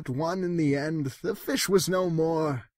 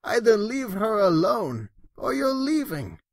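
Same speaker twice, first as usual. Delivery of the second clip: higher, louder stern telling-off; scolding yell; 6 semitones higher, 6 dB louder than usual. Acted. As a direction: lay down the law to the cat. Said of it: "yelled the girl".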